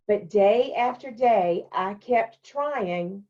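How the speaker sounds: put-on brighter storytelling voice for the children; story reading. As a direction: read it like a picture book to kids. Teacher reads picture book aloud.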